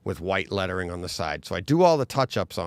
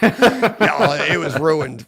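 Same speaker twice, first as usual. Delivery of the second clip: high, loud, laughing through it; rueful laughing exclamation; 6 semitones higher, 9 dB louder, much slower than usual. Real, not acted. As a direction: laugh it out, can't believe it. Laughing about ruined bike.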